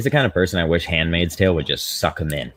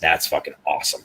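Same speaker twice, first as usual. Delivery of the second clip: ordinary talk, nothing marked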